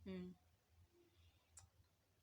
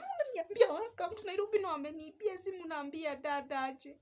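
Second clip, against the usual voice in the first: upset wail; 5 semitones higher than usual. Retold or imitated. Upset retort at brother's laughing.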